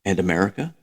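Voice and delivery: usual speaking voice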